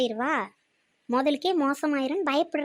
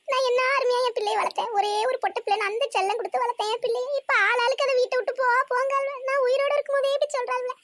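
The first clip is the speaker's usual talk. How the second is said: high, wailing lament for her daughter; high-pitched wailing complaint from a mother-in-law; 8 semitones higher than usual. Acted. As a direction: cry it out like a wronged mother. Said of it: "எம்மா அழாதம்மா".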